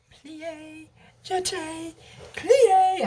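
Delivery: lightly sung ballet calls, high and airy; mock ballet. Mocking the ballet scene, lightly sung high calls. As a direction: chirp the steps like a ballet teacher.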